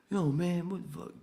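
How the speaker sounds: high, loud hammed-up outburst; mock angry coworker. Coworker snapping at him, high loud mock yell. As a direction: play the hothead yelling back.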